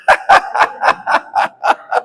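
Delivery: breathy, voiceless staccato cackle; a string of evenly spaced mocking ha-ha bursts; mocking demon laugh. Demons laughing at the inexperienced minister, mocking staccato cackle. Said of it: "Y los demonios".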